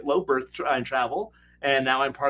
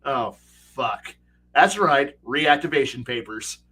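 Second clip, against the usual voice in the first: ordinary talk, nothing marked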